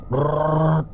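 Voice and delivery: rolled trill of a vibrating bottle; buzzing rolled-lip whirr; imitated rattling. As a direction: imitate something spinning fast.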